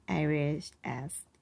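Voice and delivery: ordinary delivery, nothing marked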